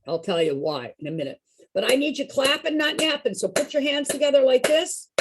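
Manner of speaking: usual speaking voice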